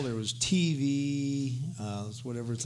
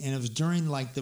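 ordinary delivery

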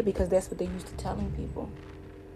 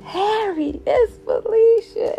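calling out to Harry across the house, high and loud; high, loud call to husband; excited call; 14 semitones higher, 7 dB louder, slower than usual. Retold or imitated. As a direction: shout to her husband in another room. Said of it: "she screamed".

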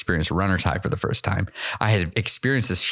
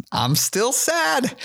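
much higher, slow, drawn-out mock whine; exasperated whine; much slower than usual. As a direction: stretch it out high like a frustrated kid. Still sad despite harder running.

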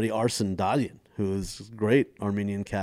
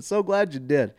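hammed-up grateful reply in a raised pitch; gushing flip; 6 semitones higher, 3 dB louder than usual. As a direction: switch suddenly to over-delighted gratitude. Repeats the sudden gushing reply.

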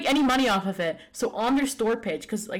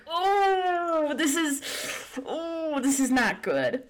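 rising dismay, voice cracking up high; dismayed cry; 7 semitones higher, much slower than usual. Retold or imitated. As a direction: groan it out in pained dismay. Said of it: "I'm like".

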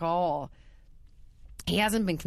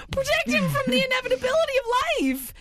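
laughing; 5 dB louder than usual. Retold or imitated. Laughing while lecturing her partner.

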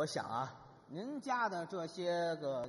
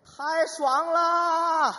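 very loud, high and drawn out slowly; comic yell; 10 semitones higher, 14 dB louder, much slower than usual. Acted. Wife surrounded by thugs on the bus, drawn-out yell. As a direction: belt it out like a long wild cry. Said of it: "喊吧".

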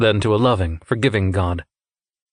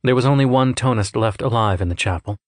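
plain reading voice

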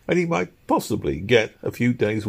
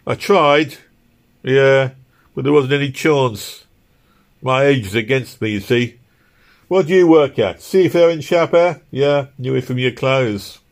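put-on higher, louder old London labourer; working man; 6 semitones higher, 6 dB louder than usual. A narrator's reading. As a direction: talk as a rough-spoken working man, pitched up.

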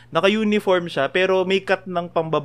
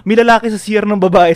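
louder, alarmed announcement; alarmed report; 4 semitones higher, 9 dB louder than usual. Retold or imitated. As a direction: blurt out the news in a mock panic. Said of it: "Sabi ko".

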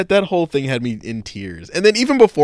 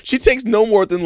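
ordinary talk

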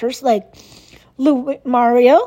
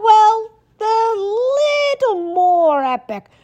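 dramatic epic emphasis; 9 semitones higher, slower than usual. Pretend.

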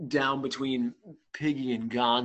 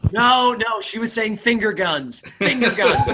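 loud, high objection with laughter; joking protest; 8 semitones higher, 8 dB louder than usual. Real, not acted. Contestant jokingly disputes the co-player's answer, loud protest. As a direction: shout the host down in fun.

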